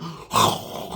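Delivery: long noisy mock snore; imitated sleeping breath. Imitating Hurley asleep in the back, noisy sleeper's breath. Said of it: "I could literally see Hurley sleeping".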